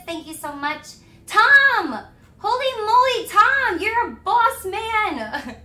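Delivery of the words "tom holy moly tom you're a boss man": loud, high whoops of amazement; excited shouting. Real, not acted. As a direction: shout the donor's praises, thrilled. Celebrates a big donation.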